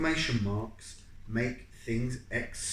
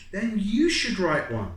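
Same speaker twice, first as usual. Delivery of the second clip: retort; 8 semitones higher, 7 dB louder than usual. Acted.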